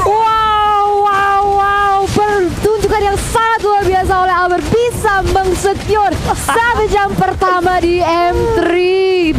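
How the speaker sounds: high, slowed wow of amazement; amazed hype. Real, not acted. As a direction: marvel at the play. Co-caster amazed by savage.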